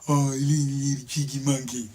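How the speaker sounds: put-on playful baby talk; cooing at a baby. Adult to a baby being called cheeky monkey, cooing. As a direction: coo it softly as to a little one. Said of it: "called a baby a little cheeky monkey".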